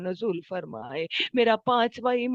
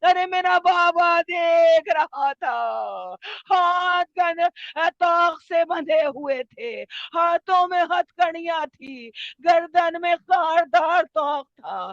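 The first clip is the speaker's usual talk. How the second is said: long wailing phrases; writhing in grief; 4 semitones higher, 5 dB louder than usual. Acted. Imam recalling his chained father looking on, anguished wail. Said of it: "تڑپ کر کہتے ہیں".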